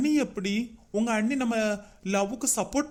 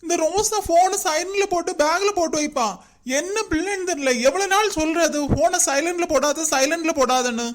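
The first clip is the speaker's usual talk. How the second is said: higher, louder exasperated rant; frustrated grumble; 7 semitones higher, 4 dB louder than usual. Acted. Son grumbling to himself that Rosa won't pick up, exasperated. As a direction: fume at the unanswered phone. Son fuming over unanswered calls.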